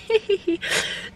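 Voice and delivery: a short, breathy giggle at herself; a small embarrassed giggle; embarrassed laugh. Real, not acted. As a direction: laugh it off awkwardly. Embarrassed at passerby.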